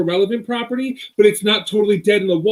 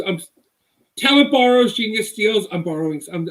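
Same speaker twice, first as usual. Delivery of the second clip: ordinary delivery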